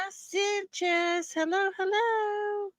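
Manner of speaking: playful squeaky greeting; high sing-song greeting. Pretend. Greeting a viewer.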